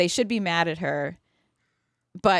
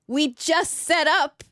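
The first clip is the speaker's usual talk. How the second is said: excited; 8 semitones higher than usual. Real, not acted. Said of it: "excited realization".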